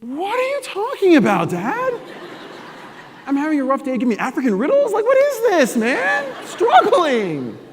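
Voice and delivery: put-on very high, loud teen whine; exasperated teen. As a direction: protest like an annoyed teenager. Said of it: "arguing with dad as teen".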